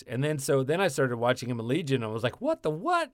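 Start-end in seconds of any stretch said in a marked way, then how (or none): 2.4-3.1 high-pitched retold disbelief; baffled surprise. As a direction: squeal it in mock bewilderment. Retold reaction to seeing the show.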